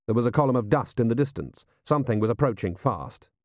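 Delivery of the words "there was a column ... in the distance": usual speaking voice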